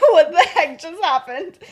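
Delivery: high, loud, stunned exclamation; mock shock. Remus hearing that voice for the first time, hammed-up shock. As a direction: blurt it out in exaggerated disbelief. Retold shocked reaction to the fight.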